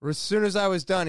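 ordinary talk

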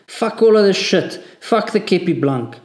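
high, loud raging yell; furious shout. A narrator's reading. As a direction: scream it as you quit. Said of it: "he shouted".

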